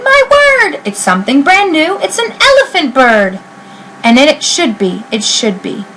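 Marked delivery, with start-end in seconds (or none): 0.0-3.4 high, wondering exclamation; amazed crowd. Acted. Circus crowd marveling at the elephant bird, high and wondering. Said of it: "they shouted".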